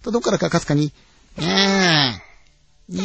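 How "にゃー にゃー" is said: slow drawn-out imitated meow; a faraway mewing, long and thin; faint cat meow. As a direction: stretch out a distant cat's cry. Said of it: "鳴き声が聞こえたんですね".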